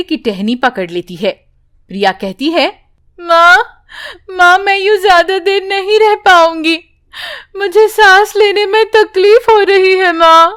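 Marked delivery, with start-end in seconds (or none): 3.2-10.5 put-on a child running out of strength; struggling little girl. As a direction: daughter telling her mother she cannot breathe.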